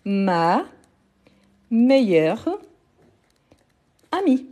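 "ma... meilleure... amie", long pauses between words. Shown as a demonstration. Teacher giving the feminine example, word by word. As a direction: set "ma meilleure amie" out one word at a time.

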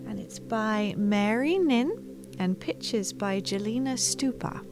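usual speaking voice

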